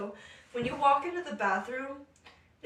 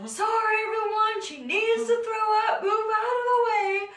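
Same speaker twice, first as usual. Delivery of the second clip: high, loud announcement; mimicked urgent holler; retold crowd-clearing call; 11 semitones higher, 9 dB louder than usual. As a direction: call out over a queue to let her through. Retold boyfriend clearing the bathroom line.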